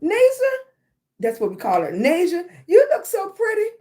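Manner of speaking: put-on high, loud, gushing praise to a little girl; aunt cooing over her niece's looks, high and loud. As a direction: squeal it out with delight, high and loud. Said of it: "I was like".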